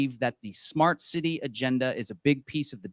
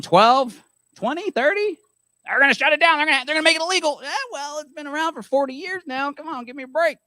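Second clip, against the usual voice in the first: put-on higher pitched crotchety Texas old-timer; old Texas man voice; 12 semitones higher, 5 dB louder than usual. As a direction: grumble like an old Texas codger. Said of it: "That was my old man voice. My old Texas yippee skippy voice".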